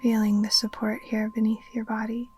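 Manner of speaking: usual speaking voice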